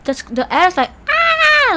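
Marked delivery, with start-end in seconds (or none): 1.1-1.7 high, loud mock shriek; imitated ad scream. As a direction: scream like the women in a horror ad. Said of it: "they're just ladies screaming".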